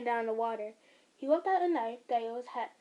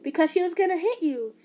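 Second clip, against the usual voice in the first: loud; 5 semitones higher, 7 dB louder than usual. Acted. Mother defends herself.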